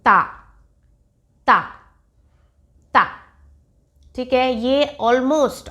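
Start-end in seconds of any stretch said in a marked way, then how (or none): 0.0-3.0 ता repeated with the tongue out between the teeth, crisp; ता, clear and separated like a drill; ta syllable drill. Shown as a demonstration. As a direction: model a single syllable ता for practice. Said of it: "single syllable means".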